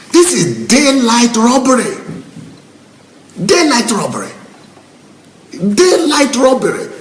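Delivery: high-pitched indignant protest; real exasperated outrage; outraged. Real, not acted. Outraged rant against charlatan preachers.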